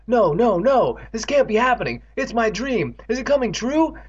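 high-pitched rising alarm; alarmed disbelief. Acted. Evan sees his nightmare coming true, alarmed.

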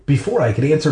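plain reading voice

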